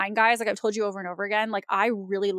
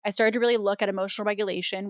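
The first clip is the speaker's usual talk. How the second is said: usual speaking voice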